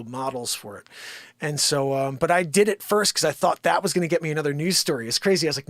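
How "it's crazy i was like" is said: ordinary talk, nothing marked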